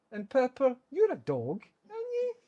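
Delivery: high, sing-song pet voice rising up at the end. Real, not acted. Owner greets the other dog Pepper in a high, sing-song pet voice. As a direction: coo up at the little dog.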